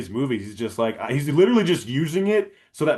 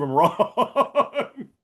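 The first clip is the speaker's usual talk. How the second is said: laughing; 9 semitones higher than usual. Real, not acted. Joking jab, drawn out laughing.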